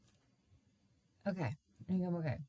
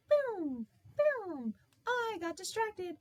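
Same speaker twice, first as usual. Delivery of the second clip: much higher, ditzy scattered voice; scatterbrained; slower than usual. Retold or imitated. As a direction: play a distracted airhead. Voices her scattered ADHD self.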